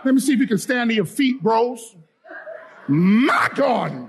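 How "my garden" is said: slow "My" stretched wide, "garden" falling; slow rallying call leaning on "My"; emphatic demonstration. Shown as a demonstration. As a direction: draw out "My" with force, then drop "garden". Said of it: "keep doing this till you get it right".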